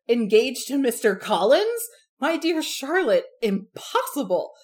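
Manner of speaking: loud, slow, high exclamation of disbelief; crying out in disbelief. Retold or imitated. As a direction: cry it out, stunned that her friend would marry him. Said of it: "cries out".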